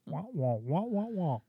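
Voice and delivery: Charlie Brown teacher wah wah; trombone-like wah wah syllables; muffled wah wah talk. Pretend. Imitating muffled adult talk.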